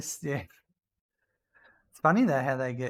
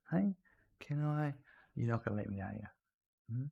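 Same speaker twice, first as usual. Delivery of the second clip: soft pet talk; 6 dB quieter than usual. Real, not acted. Owner cooing softly to his old dog Randy, tender.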